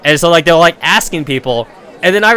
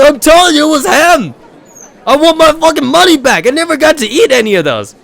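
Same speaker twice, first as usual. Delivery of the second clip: much higher, loud outraged demand; furious insisting yell; 12 semitones higher, 5 dB louder than usual. Retold or imitated. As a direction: yell like you've been robbed. Jock insisting, demanding money.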